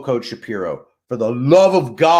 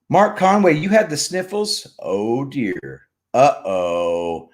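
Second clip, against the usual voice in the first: ordinary talk, nothing marked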